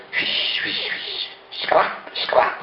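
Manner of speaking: unvoiced swish and scrap, like chalk scraping; whispered chalk sounds. A narrator's reading. Variconi mocks his colleagues with whispered chalk sounds. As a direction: whisper the chalk noises with a malicious smile. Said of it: "replicò con un sorriso malevolo".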